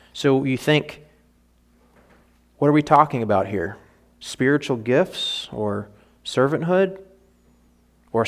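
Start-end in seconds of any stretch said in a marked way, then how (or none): none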